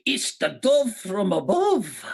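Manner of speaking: put-on Scottish impression; Scottish accent. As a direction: stretch it out in a comic Scottish accent. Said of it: "again in a Scottish accent".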